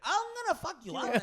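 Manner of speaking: loud, high yelled threat; hammed-up shouting; mock threat. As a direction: imitate a hothead threatening a fight. Acting the aggressor's threat.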